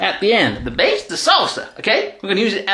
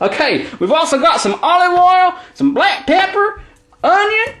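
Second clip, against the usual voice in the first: put-on dodgy Texan accent. Texan tennis player hosting his cooking show, hammed-up Texan accent. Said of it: "The accent might sound a little bit dodgy".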